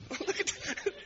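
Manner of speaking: laughing. Real, not acted. Laughs mid-story.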